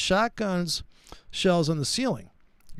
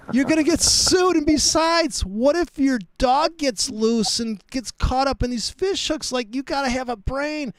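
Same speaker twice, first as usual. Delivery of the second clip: exasperated retort; 7 semitones higher than usual. Retold or imitated. Telling a friend he's lost his mind, high and exasperated. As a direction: push back on him with a laugh.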